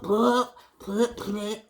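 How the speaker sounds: playful bloop noises as she paints; bloop sound effect. Shown as a demonstration. Painting teacher adds playful bloop effects to class. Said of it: "Sound effects makes it play".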